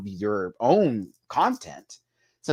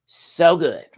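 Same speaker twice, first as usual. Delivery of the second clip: high, loud, gushing "So good"; gushing praise; 5 semitones higher, 7 dB louder than usual. Real, not acted. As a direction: rave about it with a burst.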